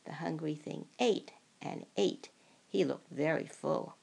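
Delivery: usual speaking voice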